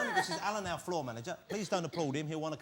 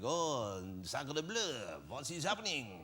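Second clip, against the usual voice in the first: mock French; 3 semitones lower, much slower than usual. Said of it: "full-on kind of comedy cinematic".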